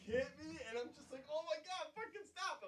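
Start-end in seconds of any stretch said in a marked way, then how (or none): 1.3-2.7 high-pitched retold plea to stop; imitating a helpless giggler; giggly protest. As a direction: beg them to quit through the giggles. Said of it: "I'm laughing, 'cause I'm like, I got the little giggles".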